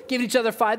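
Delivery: ordinary talk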